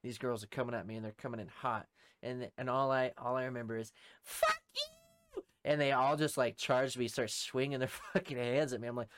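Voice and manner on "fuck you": very high mock screech; imitated yell. Girls charging at him at the party, imitated high screech. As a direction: mimic a furious screech.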